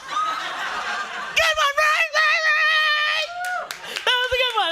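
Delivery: high, laughing, drawn-out call; laughing brag. Real, not acted. Comic asks Riley to back up her joke, laughing. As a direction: laugh and ask for backup. Fishing for crowd approval, laughing.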